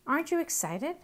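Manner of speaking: high, eager bird voice; eager question. Acted. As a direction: ask it brightly, pitch rising.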